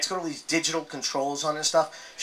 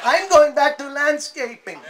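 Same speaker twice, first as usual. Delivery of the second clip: exasperated outburst; 10 semitones higher, 7 dB louder than usual. Acted. Exasperated outburst about the park.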